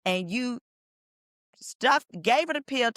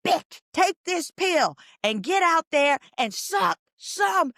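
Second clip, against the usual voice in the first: mock judge yell; 8 semitones higher, slower than usual. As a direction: bark the judge's words as a mock shout. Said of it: "quote unquote".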